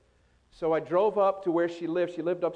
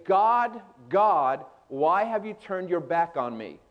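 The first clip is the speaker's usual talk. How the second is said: ordinary talk, nothing marked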